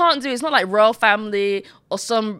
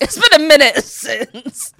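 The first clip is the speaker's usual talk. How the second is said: louder, higher, with a laugh breaking in; gleeful retold reaction; 7 semitones higher, 7 dB louder than usual. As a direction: act out your delight at the drama. Acting out own gleeful reaction online.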